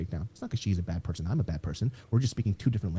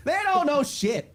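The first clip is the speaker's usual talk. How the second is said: mock outrage; much slower than usual. Real, not acted. Ranting mockingly about friends.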